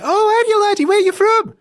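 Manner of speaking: very high, loud mock-Scottish woman; hammed-up Scottish girlfriend, shrieked greeting; imitated Scottish accent. Girlfriend greets the supposed Scot, very high loud mock-Scottish. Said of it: "she's Scottish, and she's like".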